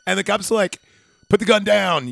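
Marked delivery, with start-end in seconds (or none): 1.3-2.1 cop's command. Retold or imitated. Retold cop giving an order.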